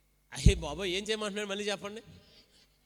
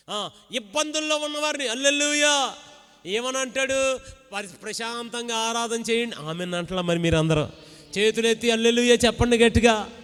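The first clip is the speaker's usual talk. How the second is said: higher, loud worship exhortation over music; preaching at full force; 4 semitones higher, 11 dB louder than usual. Acted. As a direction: lift the church into praise. (సంగీతంతో బిగ్గరగా ఆరాధన ప్రసంగం)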